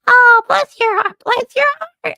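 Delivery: a sped-up little critter saying bless your heart; southern chipmunk. Pretend. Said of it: "you said a southern chipmunk on here".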